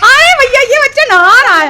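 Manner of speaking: loud, high laughing exclaim. Real, not acted. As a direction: giggle it out loud. Laughing at the bathing child.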